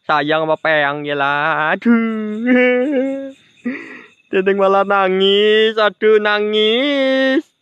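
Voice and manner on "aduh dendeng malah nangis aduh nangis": slow, whiny fake weeping; mock crying. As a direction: ham up a crying ghost. (pura-pura menangis merengek)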